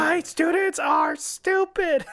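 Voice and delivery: very high, slow, drawn-out mock scream; hammed-up raging substitute teacher; screaming teacher impression. Imitating a teacher ranting about students, mock scream. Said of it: "the teacher who screams".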